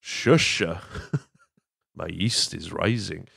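goofy, dim-witted cartoon voice; imitating a lovable oaf's dopey drawl; mock dopey Ed line. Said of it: "Ed's just like".

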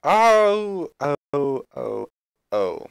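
loud high oh of realization, then fading; sudden realization. Real, not acted. Streamer spots the problem in the build, surprised blurt. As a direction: it just clicked, blurt it out.

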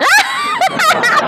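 shrill squeal of laughter; sharp high cackle; high shrieking laugh. Real, not acted. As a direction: scream with laughter. Laughing at rival's insult.

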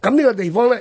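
normal talking voice

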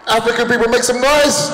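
rally the room like a hype man; crowd callout. Acted. Comic calling out to West Indians, shouted hype.

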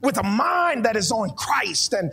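fervent prayer. Acted. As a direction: cry out to God with growing force. Preacher breaks into fervent prayer.

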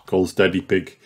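usual speaking voice

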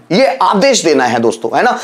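ordinary delivery

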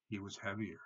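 usual speaking voice

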